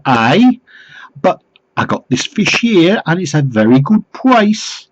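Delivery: high, loud Geordie salesman; mock Geordie accent. Fish seller insists on a good price, mock Geordie. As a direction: keep selling in a hammed-up Geordie accent. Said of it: "I don't think it was a genuine accent".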